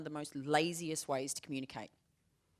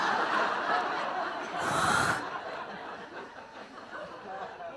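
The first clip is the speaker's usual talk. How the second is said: laughing sigh. Retold or imitated. Relieved sigh after misunderstanding.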